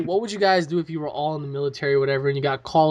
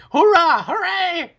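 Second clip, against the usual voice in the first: goofy high cheer; 11 semitones higher, slower than usual. Retold or imitated. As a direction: cheer like a lost kid. Mimicking Dave's cheer.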